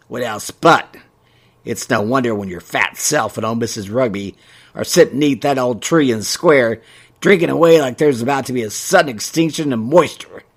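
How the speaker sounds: country voice, poking fun; mocking shopkeeper. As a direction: tease the two old ladies. Ray mocking the gossips.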